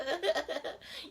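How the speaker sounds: short high laugh at her own joke; real laugh. Real, not acted. Laughing at her own impression of herself in love, high and bright. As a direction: let a laugh burst out.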